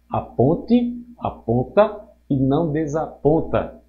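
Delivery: measured reading, drawing out "ponte" and "aponta"; slow, clear rereading. Shown as a demonstration. Teacher rereads the example so the student understands, slowly and clearly. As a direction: read it slowly, phrase by phrase. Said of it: "Vamos ler novamente pra você entender".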